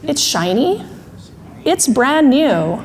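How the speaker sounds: slow, separated echo of "shiny... brand new"; drawn-out, patient correction; thinking brain. Acted. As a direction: repeat it back deliberately, with long pauses. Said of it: "Thinking brain finally says".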